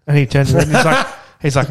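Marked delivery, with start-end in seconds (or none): none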